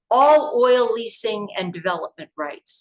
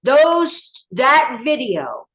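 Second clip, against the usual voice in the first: blurted out; 4 semitones higher, 5 dB louder, much slower than usual. Retold or imitated. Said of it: "he blurted something".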